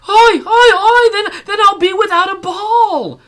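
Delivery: very high, loud wailing cry; cried out. Acted. Woman losing her ball, high wailing cry. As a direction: wail out in despair. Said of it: "The woman cried out".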